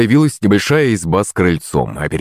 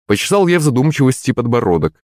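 ordinary delivery

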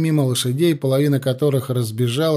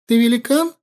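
put-on much higher, chirping child question; little girl; 9 semitones higher than usual. A narrator's reading. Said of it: "прощебетала девочка".

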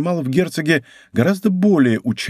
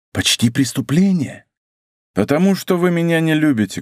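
ordinary delivery, nothing marked

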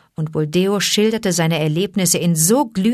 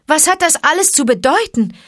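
much higher, confused question; bewildered; 10 semitones higher, 4 dB louder, faster than usual. Acted. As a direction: ask baffled as stones fly. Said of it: "fragte Mowgli ganz verwirrt". (verwirrt, viel höher gefragt)

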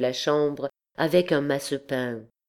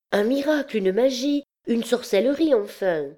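high-pitched, impatient listing; eager insistence; 8 semitones higher than usual. A narrator's reading. Girls explain to the puzzled dancer, insistent. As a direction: press her to understand, pitch up.

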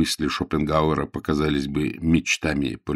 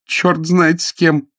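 higher, louder, drawn-out complaint; exasperated; 10 semitones higher, 6 dB louder, slower than usual. Acted. Frederick resents being shut in with Jane, exasperated. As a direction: hammer out each word in annoyance. Frederick grumbles angrily about company.

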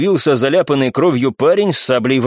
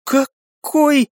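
high, puzzled question; baffled follow-up; bewildered; 13 semitones higher than usual. A narrator's reading. Friend asking who is meant, bewildered. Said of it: "с недоумением спросила подруга".

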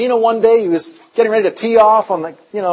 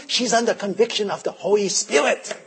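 husband's quiet report; 3 semitones lower, 8 dB quieter than usual. Retold or imitated. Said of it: "He says".

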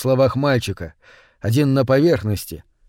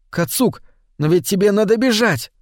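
blurted out; 8 semitones higher than usual. A narrator's reading. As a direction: blurt it out in a rush of panic. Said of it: "и он выпалил".

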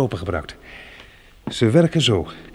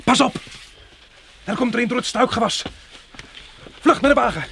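urgent shout; 11 semitones higher than usual. Acted. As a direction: yell the warning, get her moving. Warning as a creature comes.

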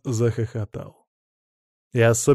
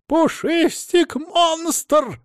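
high, slow, drawn-out shriek; much slower than usual. Acted. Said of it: "взвизгнул Адамыч".

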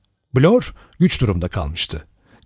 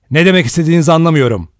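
fast, louder, higher angry retort; 6 semitones higher, 5 dB louder, much faster than usual. A narrator's reading. Doctor Armstrong angrily rebuffs Blor's accusation, fast and loud. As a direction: snap it back quick and hot. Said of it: "kızgın bir sesle".

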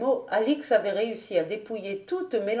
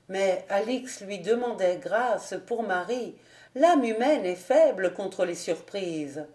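ordinary delivery, nothing marked

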